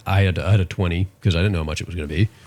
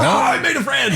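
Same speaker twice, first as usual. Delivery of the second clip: high, laughing exclamation; giddy and much higher; mock delight. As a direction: shout it in gleeful triumph. Delighted reaction in retold story.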